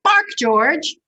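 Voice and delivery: high, loud mama dog; mother's command. Acted. Mother urging her pup to bark, high and firm. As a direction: coax him firmly to bark. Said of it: "George's mother said".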